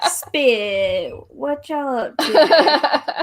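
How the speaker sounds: comedian's cursing story opener, stretched very slow; imitating a comedian. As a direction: drag out the comedian's catchphrase, playfully. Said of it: "he always starts his stories usually by cursing".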